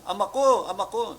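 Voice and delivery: loud high plea; calling out father. Retold or imitated. Elisha calling after Elijah, loud pleading cry. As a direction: cry out to him as a son. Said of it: "Tinatawag niya siyang".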